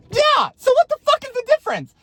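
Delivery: high-pitched retort; acted outraged shout; exasperated yell. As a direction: burst out at him, high and loud. Acts out arguing with ex.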